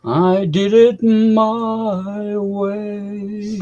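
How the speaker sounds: slow crooning of the line, lightly voiced; [name] impression. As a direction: draw it out like a crooner.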